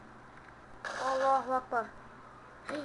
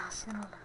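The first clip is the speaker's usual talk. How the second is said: frustrated groan; 8 dB quieter than usual. Real, not acted. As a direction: groan at failing yet again. Tekrar tekrar ölüp sinirleniyor. (uzun, bıkkın inleme)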